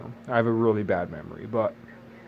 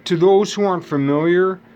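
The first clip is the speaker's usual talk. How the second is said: ordinary talk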